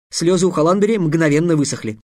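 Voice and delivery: usual speaking voice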